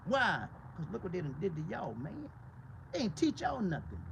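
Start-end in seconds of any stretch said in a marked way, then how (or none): none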